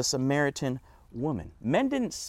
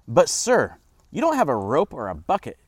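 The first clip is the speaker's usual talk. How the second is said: louder, pointed objection; voicing a skeptical woman, punched up; edge in voice; 3 semitones higher, 7 dB louder than usual. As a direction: challenge him with an edge. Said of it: "to hear the edge in her voice".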